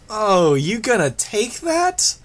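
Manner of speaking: put-on high, slow teasing girl voice; egging on. A narrator's reading. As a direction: stir up the fight, drawn out. Imp egging Regent on.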